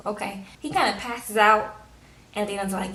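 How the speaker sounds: ordinary delivery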